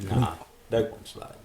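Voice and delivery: talking through hiccups; words broken by a hiccup. Real, not acted. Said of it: "Sorry, I got the hiccups".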